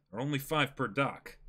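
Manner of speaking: normal talking voice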